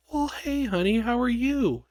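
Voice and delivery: high, sweet sing-song greeting; talking to a child. Retold or imitated. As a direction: imitate cooing hello to a little kid. Greeting a five-year-old, retold.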